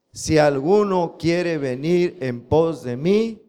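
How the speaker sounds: slow, word-by-word memory verse reading; slow, measured scripture recitation. Shown as a demonstration. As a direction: read it slowly so they can repeat. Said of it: "A ver, otra vez".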